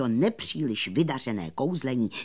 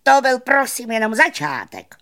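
modest magician; 11 semitones higher, 8 dB louder than usual. A narrator's reading. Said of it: "řekl skromně".

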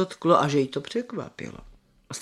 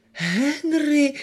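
high, drawn-out feeble questioning voice; feeble old man; 8 semitones higher than usual. A narrator's reading. Old man querying his brother's name, feebly. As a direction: ask it weakly in a raised, put-on voice. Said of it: "takovým strašně slabým hlasem".

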